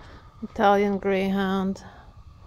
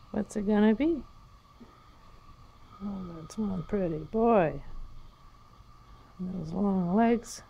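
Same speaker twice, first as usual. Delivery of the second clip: normal talking voice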